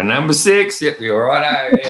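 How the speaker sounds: lightly hammed takeaway worker calling out; imitating takeaway shop worker. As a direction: call the order number like the shop guy. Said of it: "and they go".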